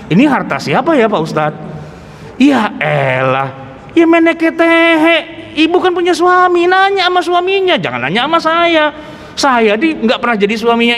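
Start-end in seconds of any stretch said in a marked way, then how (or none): 2.4-8.8 exasperated retort. Retold or imitated. Preacher to the widow, exasperated and much higher. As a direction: throw the question back, fed up.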